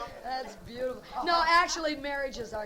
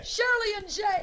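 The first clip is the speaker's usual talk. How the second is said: amused surprise; 9 semitones higher, 6 dB louder, slower than usual. Real, not acted. Host echoes odd couple names.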